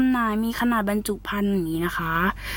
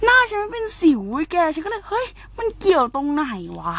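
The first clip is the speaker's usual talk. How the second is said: high, gushing boast about clear skin; excited bragging; 7 semitones higher than usual. Real, not acted. Vlogger bragging to viewers about her skin, high excited squeal. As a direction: squeal it like you can't believe your luck.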